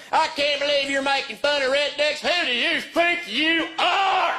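high, slow indignant church lady; offended church lady. Retold or imitated. Church lady confronting the comedian, high and indignant. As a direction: huff like an outraged churchgoer. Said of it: "this lady gets up".

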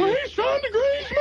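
high indignant boss voice with a laugh; Joe impression. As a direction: squeal it high and outraged. Boss voiced in retold prank.

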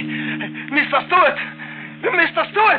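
high, slow anguished calling of his name; panicked calling over the body; frantic calling. Acted. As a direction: call out to him in panic. Calling to fallen man.

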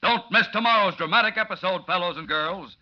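loud, high booming promo; old radio announcer. Acted. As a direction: hype the next episode. Closing announcement.

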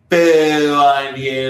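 long, loud drawn-out boo; mock booing. Drunk heckling people who won't bring pizza, loud drawn-out booing. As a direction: hold the boo on one steady note.

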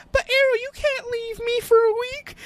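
very high mock-whiny girl voice; mocking Felicity complaining to the Arrow in a high whine. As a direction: squeak it out like a pouty girlfriend. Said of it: "Felicity's like".

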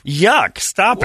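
high, loud grossed-out cry; disgusted yelp. Real, not acted. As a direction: squeal in disgust. Disgusted reaction.

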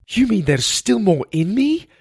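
high, loud, incredulous question; alarmed disbelief. Acted. Poisoned boy to healer, alarmed and incredulous. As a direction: voice leaps up in dismay. Learns poison remains in him.

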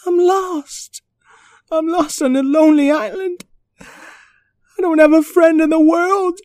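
high, slow tearful whimper; crying puppy voice. Acted. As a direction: sob it out like a lost pup. Lost puppy cries alone.

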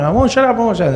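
high-pitched defiant retort; defiant mother. Retold or imitated. Wife answering husband back, defiant mock yelling. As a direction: imitate stubborn outrage, voice way up.

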